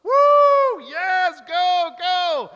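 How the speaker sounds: very high, loud ecstatic cheer; cheering fan. Retold or imitated. Football fan cheering the Browns on at the TV, ecstatic whoops. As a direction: whoop like your team just scored. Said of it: "other moments I'm like".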